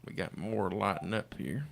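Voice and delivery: loud, upbeat on-air caller greeting; radio contest promo. Real, not acted.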